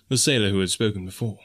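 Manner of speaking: plain reading voice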